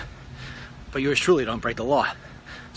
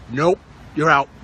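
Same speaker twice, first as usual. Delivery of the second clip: stern coach; 6 dB louder than usual. Pretend. Coach refusing again.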